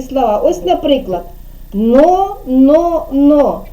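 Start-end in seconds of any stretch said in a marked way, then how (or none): 1.7-3.6 "но, но, но" as separate, louder beats; reading out syllables. Shown as a demonstration. Teacher starting a word game, "но, но, но" syllable by syllable. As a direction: chant "но, но, но" with gaps between. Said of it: "читати с-, склади, починати".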